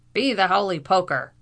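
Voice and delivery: loud, indignant Irish exclamation; furious oath. A narrator's reading. Captain repeating his oath at the arrivals, furious. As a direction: repeat the oath loudly and angrily. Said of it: "his eyes glaring furiously".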